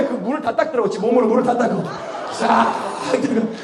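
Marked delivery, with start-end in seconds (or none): none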